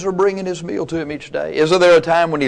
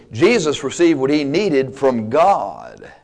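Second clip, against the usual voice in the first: ordinary delivery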